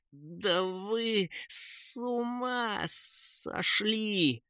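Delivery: faint, high, drawn-out fury; hissing. A narrator's reading. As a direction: strangle the outrage into a slow, quiet squeal. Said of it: "шипел Кейн". (злобное сдавленное шипение)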